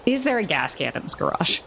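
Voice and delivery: ordinary delivery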